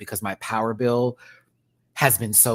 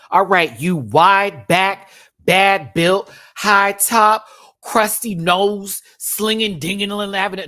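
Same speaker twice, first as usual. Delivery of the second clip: louder, higher, slow, punchy insults; playful roast; 8 semitones higher, 9 dB louder, slower than usual. Real, not acted. Roasting Dooley, who is watching, with loud, slow, playful insults. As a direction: drag him one slow jab at a time.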